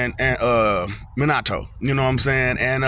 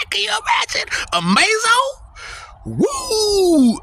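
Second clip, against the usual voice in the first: hyped shout; slower than usual. Real, not acted. Boasting to viewers about his Amazo life, high excited shouting. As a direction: shout it out thrilled.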